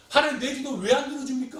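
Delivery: high, loud protest; indignant complaint. Acted. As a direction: demand an answer from God. Acted complaint to God over prayer.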